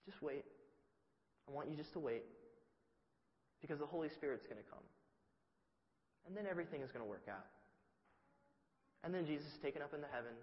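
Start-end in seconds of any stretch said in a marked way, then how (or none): 0.0-7.5 softly, settling anxious friends; gentle quiet promise, picking up pace; calm reassurance. Retold or imitated. Jesus calmly telling the disciples to wait.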